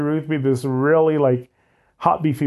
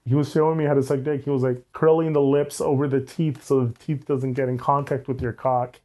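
ordinary delivery

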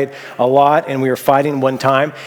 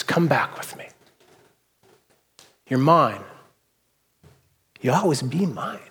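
softer, gentle call home; tender plea; 6 dB quieter than usual. Retold or imitated. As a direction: win back an unfaithful wife gently. Hosea calls back his wife.

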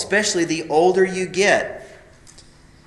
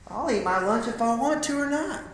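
higher mock defiant teen reply; defiant teenager; 9 semitones higher than usual. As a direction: sulkily push back at mom. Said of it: "And going".

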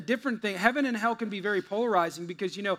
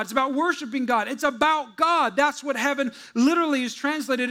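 preaching; 6 semitones higher, 5 dB louder than usual. Acted. Pastor preaching to the class, higher and building. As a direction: drive home that heaven is God.

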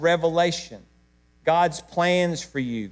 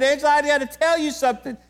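higher, urgent and moved; telling his wife with feeling rising; emotional; 10 semitones higher than usual. Retold or imitated. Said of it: "I'm about as emotional as I am right now".

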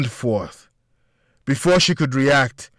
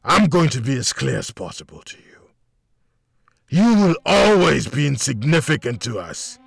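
gravelly menacing villain taunt; hostile villain. A narrator's reading. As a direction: sneer and threaten with contempt. Villain taunting cornered officer.

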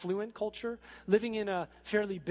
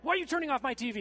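put-on irritated kid; 8 semitones higher, 3 dB louder, much faster than usual. Irritated kids interrupted from TV.

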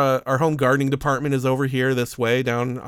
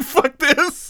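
high, louder, laughing fed-up exclamation; laughing exasperation; 5 dB louder than usual. Retold or imitated. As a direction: laugh it off in disbelief. Walking out of the interview.

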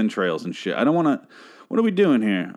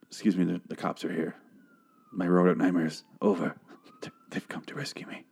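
very soft, low voice sinking to a whisper; hushed mock rescue hope; hushed acted plea; 4 semitones lower, 7 dB quieter than usual. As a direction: play it barely audible, fading into a whisper.

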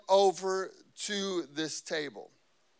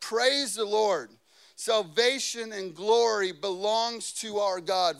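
raised, ringing preacher's reading; forceful scripture reading; 3 semitones higher, 6 dB louder, slower than usual. Real, not acted. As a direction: proclaim the verse with emphasis.

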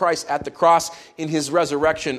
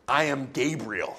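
ticked-off angel; 3 semitones lower, 7 dB quieter, slower than usual. Acted. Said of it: "The angel gets ticked off".

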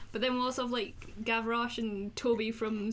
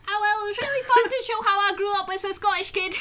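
mock Scottish; 10 semitones higher, 9 dB louder than usual. Playing Lynn Ramsey in mock interview.

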